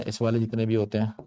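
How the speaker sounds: normal talking voice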